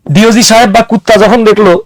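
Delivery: normal talking voice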